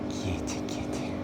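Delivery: unvoiced whisper to a cat; hushed kitty call. Real, not acted. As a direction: whisper to it gently.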